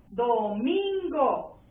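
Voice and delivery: "Domingo" drawn out slowly, leaning on "min"; stress on MIN. Shown as a demonstration. Teacher asking which syllable is strongest, stressing "min" in "Domingo". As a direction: stretch "Domingo" with the weight on "min". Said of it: "En min".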